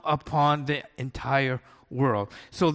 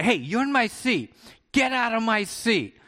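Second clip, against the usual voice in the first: high, rude demand from a pushy passenger; obnoxious passenger; 7 semitones higher than usual. Retold or imitated. Pastor mimics a rude couple taking their seat, mock rude. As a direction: bark it like an entitled traveller. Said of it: "they'd be very obnoxious".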